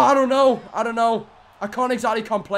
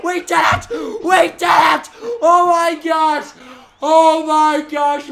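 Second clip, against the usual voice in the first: slow, stretched, louder victory cries; elated shouts; 5 semitones higher, 5 dB louder, slower than usual. Real, not acted. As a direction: drag out every word in triumph. Celebrating championship win.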